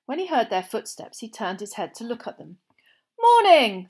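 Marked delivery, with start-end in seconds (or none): 3.2-3.8 cheerful, raised, friendly hello; bright greeting. A narrator's reading. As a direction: greet him brightly, like an eager visitor. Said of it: "said Mister Weasley brightly".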